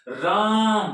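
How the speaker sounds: राम with a high, strong रा; stress on राम. Shown as a demonstration. Teacher showing students the stressed sound, stress on राम. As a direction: punch the रा of राम, let the म trail. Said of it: "ऊंची आवाज आती है ना राम बोलने में".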